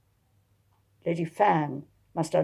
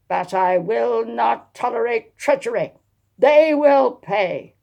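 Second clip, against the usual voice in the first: slow, louder, higher threat that swells at the end; furious threat; 6 semitones higher, 9 dB louder than usual. Acted. Dowager threatens her treacherous family in fury. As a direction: spit out a vow of revenge. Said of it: "She banged a fist".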